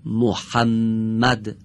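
محمد drawn out very slowly, holding the doubled م; slow gemination demo. Shown as a demonstration. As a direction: stretch محمد, lean on the double m. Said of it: "خوب دقت کنید".

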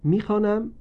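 ordinary delivery

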